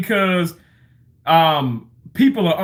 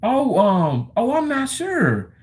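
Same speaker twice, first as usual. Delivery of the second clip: unsure reply; 7 semitones higher, slower than usual. Retold or imitated. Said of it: "I said".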